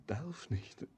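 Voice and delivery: whisper. Acted. Hushed aside in lament.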